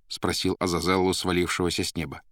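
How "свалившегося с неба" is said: ordinary delivery, nothing marked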